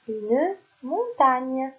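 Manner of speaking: une montagne drawn out, syllable by syllable; slow sounding-out; slow dictation. Shown as a demonstration. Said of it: "teacher dictates word slowly".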